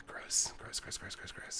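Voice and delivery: whispered gross. Real, not acted. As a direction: whisper it to yourself in disgust. Muttering at bad luck.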